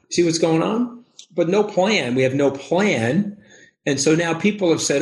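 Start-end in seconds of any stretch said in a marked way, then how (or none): none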